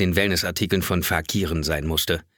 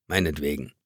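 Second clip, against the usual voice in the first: an annoyed, softer mumble; grudging mutter; 7 semitones lower, 5 dB quieter than usual. A narrator's reading. Narrator gives in to his wife, muttering crossly. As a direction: give in with a sulky murmur. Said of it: "murmelte ich ein ärgerliches".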